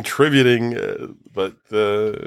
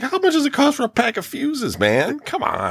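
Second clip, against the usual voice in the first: high-pitched incredulous complaint; exasperated. Retold or imitated. Passenger griping about the unsafe car, exasperated. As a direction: protest in a raised, pleading pitch.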